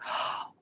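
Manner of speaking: sharp intake of breath, re-enacted; sudden gasp. Retelling the moment it hit her while driving, acted gasp. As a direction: gasp as if it just dawned on you.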